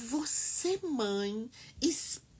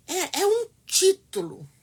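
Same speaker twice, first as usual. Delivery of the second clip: choked up, higher and louder, near tears; a sudden rush of tears on the word title; voice breaking; 5 semitones higher, 6 dB louder than usual. Real, not acted. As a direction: let the emotion crack through the words. Emotional speech about motherhood.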